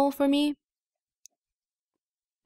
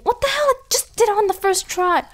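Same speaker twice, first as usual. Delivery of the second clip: amazed squeal; 11 semitones higher than usual. Real, not acted. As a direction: pitch jumps way up in disbelief. Amazed at a lucky jump.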